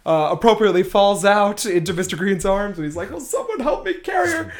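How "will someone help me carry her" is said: high-pitched mock-desperate plea; Mr. Green pleading. Imitating a man holding the falling cook, frantic whine. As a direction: whine for help, speeding up on the repeat. Mr. Green struggling under the body.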